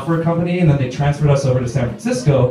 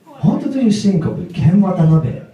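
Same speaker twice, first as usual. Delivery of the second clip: put-on higher-pitched Japanese-accented question; Japanese student accent; 5 semitones higher than usual. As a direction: ask as a Japanese learner of English. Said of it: "one of my students asked me".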